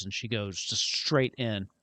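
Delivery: usual speaking voice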